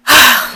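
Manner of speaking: loud, high exhaled sigh; heavy sigh. Real, not acted. Vlogger wrapping up a story about an ex, sighing. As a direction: let out a big sigh before summing up. Sighs before summing up.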